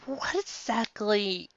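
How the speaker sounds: usual speaking voice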